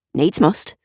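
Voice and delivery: ordinary delivery, nothing marked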